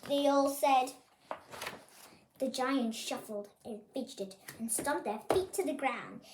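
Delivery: ordinary delivery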